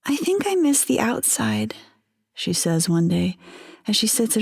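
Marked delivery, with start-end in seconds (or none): 0.0-1.8 put-on lightly raised, wistful young wife; wife's voice. Narrator reading the captive wife's memories, lightly raised voice. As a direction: voice her dreamily, a bit higher.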